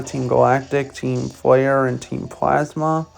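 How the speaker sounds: ordinary delivery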